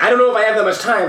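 a frazzled clerk near panic; stressed cashier. Retold or imitated.

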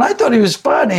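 Carson's date. Retold or imitated. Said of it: "she's going".

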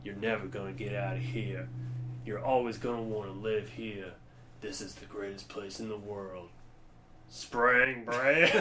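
low, hushed imitation of a movie monologue; soft mock voice-over, lingering on the last two words; James Franco. Film fan re-enacting a looped monologue for a friend, hushed impression. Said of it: "there's, like, a short monologue".

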